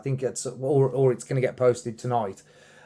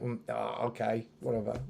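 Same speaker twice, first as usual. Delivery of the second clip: softer, uncomfortable mumbled brush-off; awkward acknowledgement; 9 dB quieter than usual. Retold or imitated. Musician fumbling a reply to a compliment, awkward and subdued. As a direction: act out not knowing how to take praise. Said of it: "I don't know what to say".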